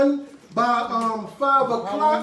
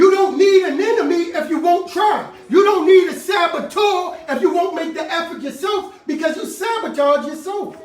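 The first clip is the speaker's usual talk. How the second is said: loud, higher fiery preaching; preaching shout; 3 semitones higher, 5 dB louder than usual. Real, not acted. Preacher rebuking self-defeat, loud shout. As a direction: call them out at full voice.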